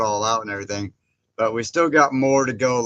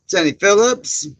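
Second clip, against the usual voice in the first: excited greeting; 9 semitones higher, 7 dB louder than usual. Real, not acted. Calling out a viewer's name.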